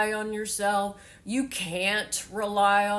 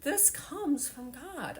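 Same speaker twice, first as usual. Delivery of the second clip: higher, tearful and breaking; crying for real while telling it; mother in tears begging her son to understand; 8 semitones higher, 3 dB quieter, slower than usual. Real, not acted. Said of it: "like what I'm doing right now".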